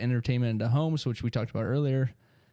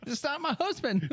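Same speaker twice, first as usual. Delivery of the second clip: high put-on wife voice, a touch of alarm; mock startled wife. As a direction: play the scared wife, pitched up high.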